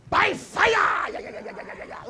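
half-joking shout of judgment. Retold or imitated. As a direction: call down fire like a zealot. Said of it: "some of us will just say".

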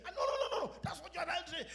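high, insistent denial; mock retort. Husband snapping back at his wife in a mock quarrel, high-pitched.

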